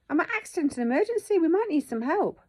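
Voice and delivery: high, loud exasperated protest; indignant. Retold or imitated. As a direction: protest in disbelief, pitched right up. Indignant retort at being refused help.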